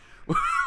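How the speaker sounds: short high-pitched laugh; real quick squeal of laughter. Real, not acted. Cracks up at own story.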